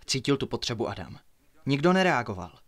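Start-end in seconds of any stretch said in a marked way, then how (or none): none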